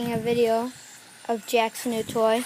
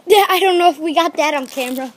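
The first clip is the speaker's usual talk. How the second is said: excited; 3 semitones higher, 16 dB louder than usual. Real, not acted. Right after the startled scream.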